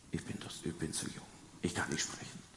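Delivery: whispered, shrinking excuse; timid whisper. Acted. Young Jeremiah whispering excuses to God, timid whisper. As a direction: hush it like a scared boy.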